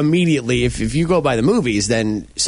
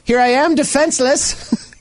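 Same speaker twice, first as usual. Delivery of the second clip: high, slow naive drawl; clueless movie victim; 11 semitones higher, slower than usual. Pretend. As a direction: play the helpless horror victim.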